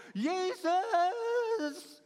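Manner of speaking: high, loud, drawn-out desperate call; cry from distress. Acted. Blind man calling Jesus out of his need, desperate cry. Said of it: "vanuit zijn nood".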